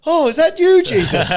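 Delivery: loud, high mock shock; mock startled cry. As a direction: cry out as if seeing a vision. Acting out feeling a heart attack.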